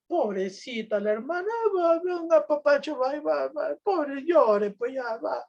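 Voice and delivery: high, sing-song pity for a crying sister, with a teary catch; mock consoling. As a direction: coo over her like a weeping child, half mocking. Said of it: "¿Qué hacemos nosotros cuando comienza a llorar?".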